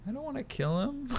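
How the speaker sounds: very high, soft, whiny plea; whiny Vader. Retold or imitated. Vader begging the Emperor, high and whiny. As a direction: whimper it like a sulky child.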